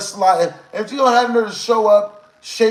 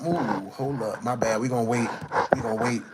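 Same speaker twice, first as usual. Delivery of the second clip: quiet aside; 7 semitones lower, 6 dB quieter than usual. Real, not acted. Aside while pausing the stream.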